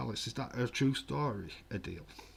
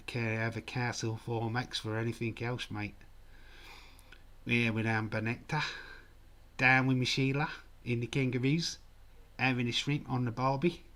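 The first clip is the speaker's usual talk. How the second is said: hammed-up Aussie, rising line ends; Australian accent; 2 semitones higher than usual. Shown as a demonstration. Streamer showing viewers his Australian accent, hammed-up Australian. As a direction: play a stock Australian bloke. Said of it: "can you do an Australian accent".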